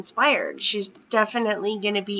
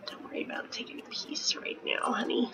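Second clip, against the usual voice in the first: whisper; 12 dB quieter, faster than usual. Real, not acted. Quiet aside to Sim.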